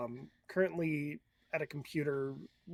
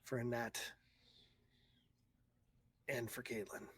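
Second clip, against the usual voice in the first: quiet resolve; 2 semitones lower, 4 dB quieter than usual. Acted. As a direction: murmur it to yourself, resolved. Man speaks to himself in mirror.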